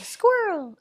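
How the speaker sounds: sudden high-pitched "squirrel!" exclamation; distracted squirrel outburst. Host mimicking her own wandering attention, sudden high outburst. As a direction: blurt it out high, mid-thought. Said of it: "Like, I'll be like".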